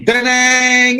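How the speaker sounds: high ringing shout of a guitar chord; guitar crash shout. Retold or imitated. Rock writers answering the phone, high shouted guitar-crash noise. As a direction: belt it like a power chord. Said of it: "Or it could be".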